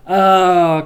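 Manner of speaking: sudden loud high gasp of an oh; shocked oh. Acted. Abby finding dead bodies at the hospital, shocked. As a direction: blurt it out at the sight of the bodies.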